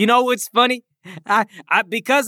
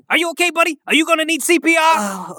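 usual speaking voice

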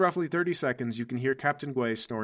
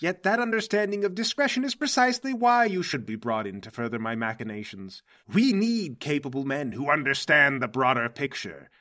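insistent mastermind justifying a decision; Mercury, grand villain boss. Acted. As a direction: press the point with theatrical authority. Game master plays Mercury.

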